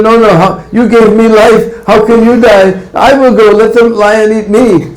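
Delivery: put-on high, childlike pleading; pleading young calf. Calf begging its mother to stay, in a high pleading voice. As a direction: beg like a small calf desperate to save its mother. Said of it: "Poor calf said".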